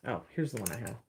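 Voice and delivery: ordinary talk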